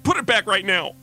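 high, fast, loud angry snap; fast, loud, irritated mom snap; snapping. Acted. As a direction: bite the words off like a frazzled mother. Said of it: "She snapped back".

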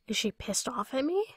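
ordinary delivery

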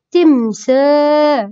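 تمساح slowly, saa held long; elongated aa. Shown as a demonstration. Teacher repeats the alif madd example, vowel stretched. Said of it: "ممدودة بالألف".

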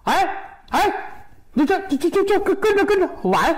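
very high, stammering panic; flustered alarm. Acted. Shopkeeper sees customer walk off with bowl, flustered stammer. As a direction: stumble over the words in a high squeal of alarm.

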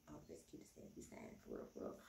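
hushed gossipy whisper about Oliver; whispered aside. Real, not acted. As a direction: lean in and whisper it like a secret.

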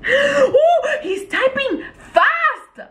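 high laughing squeal, last word stretched; excited squeal. Real, not acted. Watching him type back, high excited squeal. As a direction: squeal and drag out the final word.

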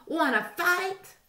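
high, slow squeaky dare; grouchy ladybug. A narrator's reading. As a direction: challenge them in a tiny high voice. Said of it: "said the grouchy ladybug".

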